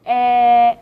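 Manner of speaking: "Eh" said open, like the e in bed; a short, open "Eh". Shown as a demonstration. Teacher showing learners the bed vowel, clearly sounded. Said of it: "eh in English word bed".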